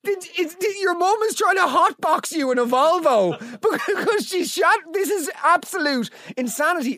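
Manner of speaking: high-pitched comic disbelief; incredulous outburst. Real, not acted. Host reacting to the listener's car story, incredulous outburst.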